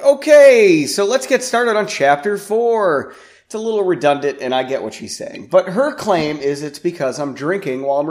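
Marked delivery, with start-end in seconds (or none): 0.0-3.1 high bright "Okay" then the intro line; chirpy podcast host, mocking himself. Podcast host imitating his own upbeat chapter intro.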